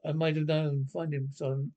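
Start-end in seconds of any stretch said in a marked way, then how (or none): none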